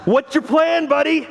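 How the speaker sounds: mock patient, really fed up; exasperated dad. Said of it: "I was like".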